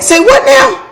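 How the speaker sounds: very high-pitched, incredulous mock yelp; retold screaming disbelief. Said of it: "So I'm screaming, I'm yelling".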